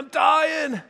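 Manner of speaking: high hammed-up dying wail; mock dying cry. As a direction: play the wounded kid in a cheesy skit. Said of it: "one guy's bleeding out".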